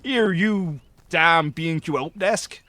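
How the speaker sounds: slow, loud West Country insult; West Country accent. Retold or imitated. Mocking how the West Country sounds threatening, slow West Country accent. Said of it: "if you've got a West Country accent".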